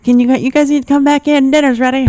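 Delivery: mock dad summoning kids for dinner; imitating the dad. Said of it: "he's like".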